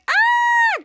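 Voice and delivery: short high loud scream; imitated scream. Charlotte screaming in the air shaft, high loud imitated scream. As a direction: shriek in a character's place. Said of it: "Cut to Charlotte screaming".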